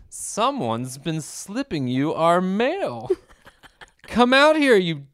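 mock menace, very slow, building to a loud finish; hammed-up villain. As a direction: draw out each phrase like a cheesy movie villain. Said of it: "like, what, Scottish accent?".

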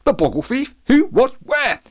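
high, loud volley of questions; flustered, alarmed bewilderment; startled. A narrator's reading. Policeman hears of the Boggle Thief, startled.

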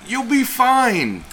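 high, drawn-out singsong reassurance; mock reassurance. Co-host playing the reassurer of nervous ex, mock reassurance. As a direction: stretch it out high, teasingly reassuring.

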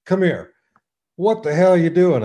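a hammed-up charge to the mound, shouting; retold angry yell. Angry batter confronts pitcher.

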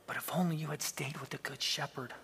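soft, sad near-whisper; almost a whisper. A narrator's reading. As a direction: say it softly, grown sad. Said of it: "he said almost in a whisper".

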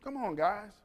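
ordinary delivery

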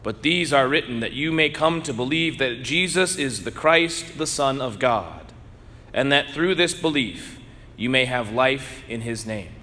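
ordinary talk, nothing marked

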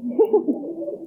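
put-on child's incredulous laugh. A narrator's reading.

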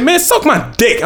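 imitating a New York accent, high and slow; high, slow New York accent imitation. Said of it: "he said it with a certain type of accent".

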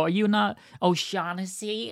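"O'Shaughnessy" as oh-SHAW-nessy, high questioning voice; high, drawn-out query on "O'Shaughnessy", silent GH; mispronouncing O'Shaughnessy. Acted. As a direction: ask it high and slow, "O'Shaughnessy" with no K sound. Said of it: "they'll go".

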